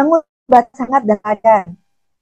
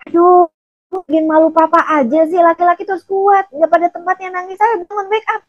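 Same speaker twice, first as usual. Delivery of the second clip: higher-pitched, exasperated father's scolding; 6 semitones higher than usual. Retold or imitated. Father scolds crying son to toughen up, exasperated scolding. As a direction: nag the boy like an embarrassed dad.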